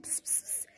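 soft pss pss to lure a cat; quick hissed calls; cat-calling hiss. Real, not acted. Luring the shy cats closer with soft hisses.

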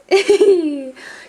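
giggling burst; high delighted laugh. Real, not acted.

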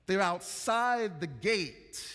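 ordinary delivery

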